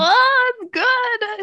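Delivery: high, loud thrilled gush. Real, not acted. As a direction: squeal with delight. Gushing over favourite scene.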